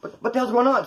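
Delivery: high startled what; shocked. Retold or imitated. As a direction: blurt it in total shock. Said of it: "it's like".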